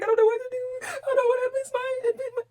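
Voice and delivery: high, crying and pleading; voix qui se brise en pleurant. Retold or imitated. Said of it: "So I'm crying again".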